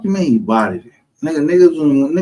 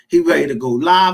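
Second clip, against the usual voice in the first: ordinary delivery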